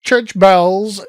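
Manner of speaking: loud mimicry of a weak American accent; exaggerated, failed American accent; mock American accent. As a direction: mockingly echo a badly faked American accent. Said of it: "weakest attempt at an American accent".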